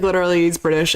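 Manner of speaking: ordinary talk, nothing marked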